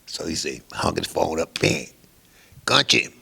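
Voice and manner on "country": loud, higher, commanding bark; gruff boss call. Retold or imitated. Mob boss to young musician, loud gruff command. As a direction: summon the kid like you own the room.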